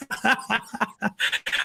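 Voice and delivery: soft, voiceless chuckle; laugh. Real, not acted. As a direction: chuckle quietly on the breath. Joking about search warrants.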